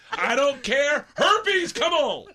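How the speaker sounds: loud, high mock bravado; hammed-up reckless shout; reckless dare. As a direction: call it out like a gambler daring fate. Acts out rolling the dice.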